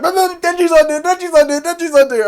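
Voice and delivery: high, loud squeaky panic; a frantic shriek in an imitation of Power blaming Denji; squeaky panicked Power. Power panicking before Makima.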